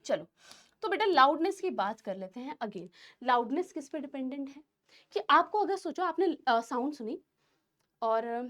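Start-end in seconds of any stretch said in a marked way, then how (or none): none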